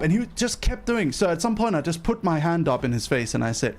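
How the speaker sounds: usual speaking voice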